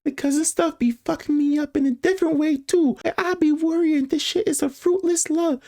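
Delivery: high-pitched cousin voice; cousin texting her crush. Acted. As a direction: read it in a high, put-on voice.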